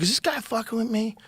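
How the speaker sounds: high put-on voice, probing; suspicious opponent voice. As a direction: hustler asks if you play good, high mock voice.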